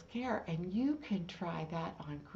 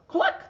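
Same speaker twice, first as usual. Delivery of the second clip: high cluck; 10 dB louder than usual. Acted. The hen answers from under the mask, high cluck. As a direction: cluck pitched way up and loud. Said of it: "says the hen".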